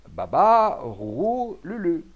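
loud, high nonsense spell; loud incantation. A narrator's reading. Rabalan running round the broken machines, loudly chanting a spell. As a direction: call out each magic word forcefully. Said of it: "en clamant d'une voix forte".

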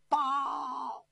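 loud, very high, long-held shout; scout's call. Acted. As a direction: call out like an arriving messenger. Scout calls out an urgent report. (高声长喊报信)

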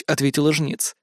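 plain reading voice

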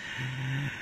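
hearty villainous laughter; loud mocking laugh. A narrator's reading. Rival laughs at the threat, loud and scornful. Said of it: "冷秋魂大笑".

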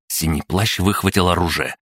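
plain reading voice

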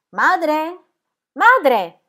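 loud, high call. A narrator's reading. Son calling his unseen mother, calling out loudly. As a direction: call for someone elsewhere in the house.

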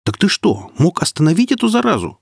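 high-pitched startled outcry; crying out. A narrator's reading. Marek questions the northerner about the plague, crying out. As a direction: exclaim it, pitch leaping up. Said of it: "вскричал Марек".

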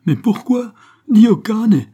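very high, loud furious yell; a worker bellowing in rage over his cousin's death. Acted. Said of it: "se mit à gueuler Sandro".

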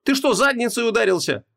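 high-pitched indignant exclamation; exasperated wife. A narrator's reading. Wife snapping back at archdemon, exasperated. Wife scolds her husband.

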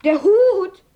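hoher, lauter Ausruf beim Anblick; überrascht. Acted. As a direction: ruf es staunend und laut heraus. Kalle entdeckt den Hut. (laut und erstaunt über Omas Hut)

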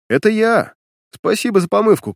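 high, bright call out; eager young soldier sounding off; excited call. Acted. Young captain cheerfully calls out.

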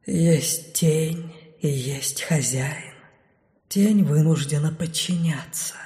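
barely there shadow voice. A narrator's reading. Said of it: "Кажется, ещё тише". (ещё тише, чем раньше)

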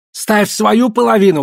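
high, forceful exclamation; exclaiming. A narrator's reading. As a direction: cry out a demand. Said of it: "воскликнул Ян Суй".

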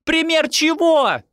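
very high, drawn-out yell; incredulous high-pitched shout. A narrator's reading. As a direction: shriek the question back in outrage. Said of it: "закричала Декла".

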